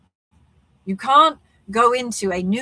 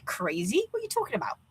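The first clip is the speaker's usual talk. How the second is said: pitched way up in mock disbelief; incredulous; 9 semitones higher, 8 dB quieter, faster than usual. To someone claiming the world vanished, incredulous. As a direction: scoff like the claim is crazy. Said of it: "you're gonna be like".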